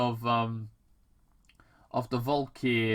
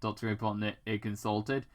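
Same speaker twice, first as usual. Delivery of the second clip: ordinary delivery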